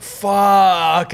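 exasperated outburst. Retold or imitated. Retelling dismay at finding mates gone, long exasperated cry. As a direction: stretch the curse out in dismay. Retold dismay at being left alone.

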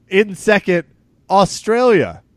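high, loud countdown announcement; hype reveal. Acted. Host announcing second place country with hype. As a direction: reveal it like a game show host.

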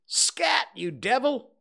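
sharp high shout at a cat; angry cry. Acted. Man yelling at a cat behind the woodshed, loud high shout. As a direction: yell it to scare the cat off. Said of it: "A cry of".

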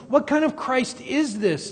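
high-pitched incredulous challenge; crowd's disbelief. Retold or imitated. Preacher voicing the crowd arguing with Jesus, incredulous. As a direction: question it as a doubting crowd would. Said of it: "they're saying".

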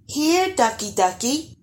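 high, coaxing call to ducks; lilting, high-pitched animal call; cooing. A narrator's reading. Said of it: "cooed the gas".

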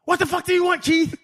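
high, loud mock-irritated curse; a coach losing patience; irritated snap. Saban curses back at the hollering lineman, a high irritated snap. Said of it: "That's what made Truck snap".

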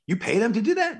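high-pitched incredulous question; retold disbelief. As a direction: act out amazement with a jump in pitch. Retells disbelief that stunts are paid.

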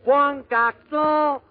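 high, loud, long-drawn call; calling out an order. Acted. Bar order for a cocktail, drawn-out loud call. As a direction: holler the order, stretching the words out. Drink order called out in joke.

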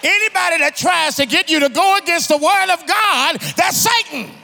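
loud, high-pitched sermon delivery; thundering preacher, driving each phrase home; full-force preaching. Acted. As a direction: preach it out to the whole congregation.

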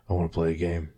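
low, soft sinister game voice; creepy villain voice. Retold or imitated. The creepy guy taunting at night, low and soft. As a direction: murmur it like a horror villain.

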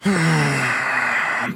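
long, heavy sigh; exasperated sigh. Acted. Agent fed up with Rowling, exasperated sigh. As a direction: let out a weary breath.

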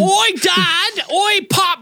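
very high, hammy Cockney call; bad Cockney accent. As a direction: call Dad in mock London accent. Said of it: "I'm so bad at the fucking accent".

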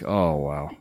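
soft, low, breathy relief; relieved sigh. Retold or imitated. Arriving at the Minneapolis toilet, a soft low relieved sigh. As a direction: let it out like finally reaching the toilet.

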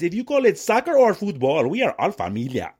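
put-on forced, high-pitched Mexican accent; hammed-up Mexican accent with punched-up pitch; fake Mexican accent. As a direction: mimic an ad's overdone Mexican accent. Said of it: "these fake forced Mexican accents".

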